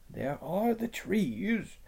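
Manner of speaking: slow, soft, high beaver voice; lisping buck-toothed beaver. Acted. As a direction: warn them in a hush. Beaver warning.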